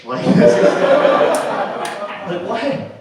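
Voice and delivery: loud, breathless laughing words; cracking up mid-sentence; laughing through words. Real, not acted. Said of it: "why, why was my".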